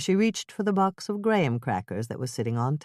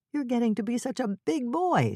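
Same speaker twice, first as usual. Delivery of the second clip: put-on higher, excited praise to a toddler; exclaiming; 8 semitones higher than usual. A narrator's reading. Mother praising her toddler, excited. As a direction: cheer the little boy on. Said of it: "she exclaimed".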